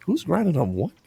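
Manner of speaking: high, incredulous mock wife voice; imitated shocked question. Imitating wife overhearing at the party, high incredulous question. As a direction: pitch it up, appalled and baffled.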